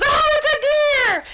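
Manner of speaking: a panicked brain spotting a shadow; the stressed brain panicking at a shadow in the driveway; startled brain. Retold or imitated. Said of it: "your brain goes".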